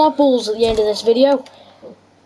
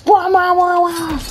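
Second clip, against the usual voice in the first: mock crying; 6 semitones higher, slower than usual. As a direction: whine it like a crybaby. Mocking AFK player as crybaby.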